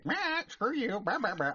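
high squawking parrot voice; swearing parrot. Acted. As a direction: squawk abuse like a foul-mouthed parrot. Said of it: "the bird's like".

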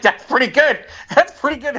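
loud, high-pitched imitated praise; amused approval. Father praising son's prank, loudly amused. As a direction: burst out with delighted approval.

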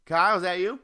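loud, high call to another room. Real, not acted. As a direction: shout across the house. Calling out to roommate.

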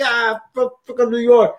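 punchy New York Italian-American impression. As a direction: mimic a proud New York Italian guy. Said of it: "he's like".

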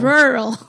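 "rural" blurted loudly as one squashed syllable; tentative pronunciation. Real, not acted. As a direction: attempt "rural", swallowing the middle. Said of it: "I think it's ru".

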